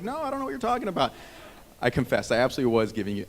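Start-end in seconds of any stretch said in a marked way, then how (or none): 0.0-1.1 very high, quick feigned innocence; hammed-up high-pitched denial; mock innocent denial. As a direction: play innocent in a squeaky-high rush. Acted denial of giving dagger eyes.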